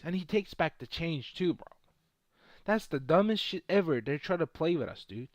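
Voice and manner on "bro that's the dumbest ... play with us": ordinary talk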